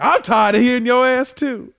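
high, loud put-on tough cat; cat's voice. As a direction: gripe loudly as the fed-up cat. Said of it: "He said".

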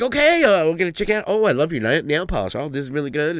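high, sing-song mock cheerful doctor; chipper doctor. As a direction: gush like a chipper pediatrician to a child. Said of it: "she's like".

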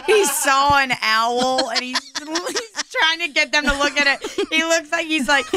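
ordinary talk, nothing marked

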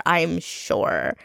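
ordinary talk

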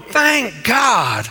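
drawn-out pulpit holler; exultant praise. Acted. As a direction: holler thanks to the heavens. Urges hollering praise like the lizard.

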